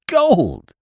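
high, amazed near-shout; almost shout it in astonishment. A narrator's reading. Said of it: "he almost shouted".

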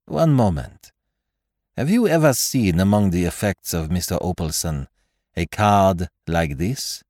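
French detective questioning, slowing at the end; French-accented detective. Acted. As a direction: inquire deliberately in a French accent. Poirot's dialogue in narration.

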